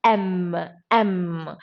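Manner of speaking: "M" as long "em", said twice; vowel-sound demo. Shown as a demonstration. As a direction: hold the hum on each "M". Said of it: "the sound is M".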